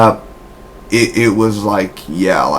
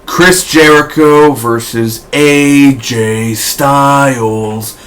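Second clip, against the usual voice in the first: announcer drawl; 3 semitones higher, much slower than usual. Acted. Announcing the matchup.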